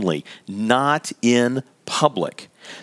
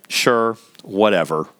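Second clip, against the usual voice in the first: slow, lower, grudging "Sure, whatever"; sulky dismissal; 5 semitones lower, slower than usual. Acted. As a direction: drag it out as if you couldn't care less. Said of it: "Eddie might say something like".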